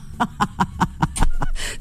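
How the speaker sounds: high, breathless squeal of laughter; airy squealed giggle. Real, not acted. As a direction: shriek with delight at the joke. Host laughs at caller's hammam.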